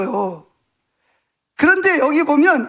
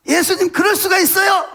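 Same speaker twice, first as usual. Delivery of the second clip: raised, higher-pitched outburst of protest; acted indignant yell in prayer; yelling at Jesus; 5 semitones higher than usual. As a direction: cry out a complaint to Jesus. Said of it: "예수님한테 소리 질러".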